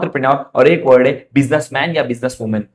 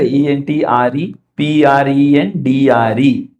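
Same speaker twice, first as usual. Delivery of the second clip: spelling letter by letter; 3 semitones lower, much slower than usual. Shown as a demonstration. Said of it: "spelling out the word".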